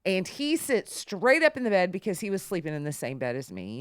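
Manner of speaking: usual speaking voice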